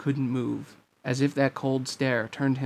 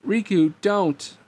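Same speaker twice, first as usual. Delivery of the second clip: higher, drawn-out cry; pleading shout; 6 semitones higher, 4 dB louder, slower than usual. A narrator's reading. Girl begging the replica not to strike, pleading shout. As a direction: beg him to stop, voice raised. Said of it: "Namine shouted again".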